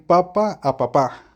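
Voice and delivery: "papa" stressed on the first syllable, then "papá" stressed on the last; papa versus papá. Shown as a demonstration. Teacher contrasting "papa" and "papá" for students, stress shifted. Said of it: "no es lo mismo decir".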